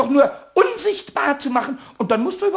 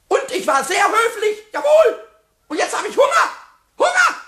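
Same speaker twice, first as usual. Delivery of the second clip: climbing higher and louder to a squeal; building indignant whine; 7 semitones higher, 3 dB louder than usual. Acted. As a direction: whine louder and louder about being hungry.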